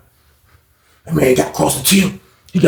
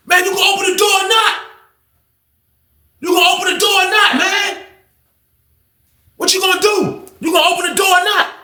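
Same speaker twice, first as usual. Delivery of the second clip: angry demand. Retold or imitated. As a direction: shout the demand over and over. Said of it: "he was like".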